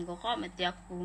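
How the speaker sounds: normal talking voice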